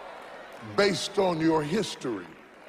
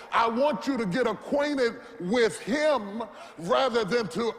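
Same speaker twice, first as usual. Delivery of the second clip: shouting sermon climax, rising higher; preaching at full force; 4 semitones higher than usual. Acted. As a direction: preach it out, calling to the crowd.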